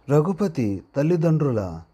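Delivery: ordinary delivery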